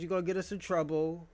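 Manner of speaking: ordinary talk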